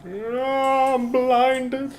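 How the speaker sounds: high, pained, hammed-up cry; mock anguish. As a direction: act blinded by the light.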